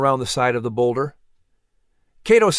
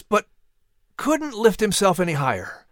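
usual speaking voice

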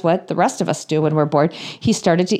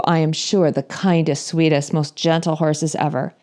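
ordinary talk

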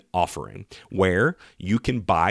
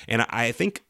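ordinary talk, nothing marked

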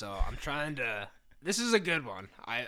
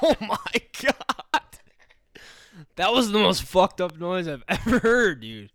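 shocked laughing; 7 semitones higher, 11 dB louder than usual. Real, not acted. Reacting to scary impression noise.

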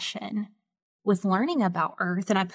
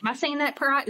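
usual speaking voice

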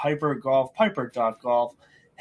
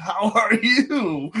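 laughing greeting; 8 semitones higher, 5 dB louder, much slower than usual. Real, not acted.

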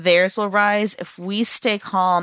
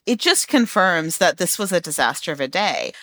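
ordinary delivery

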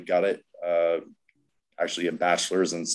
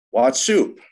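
a raised, higher mock call; 7 semitones higher, 6 dB louder than usual. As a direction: call the nickname across the room, rising at the end. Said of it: "He calls out".

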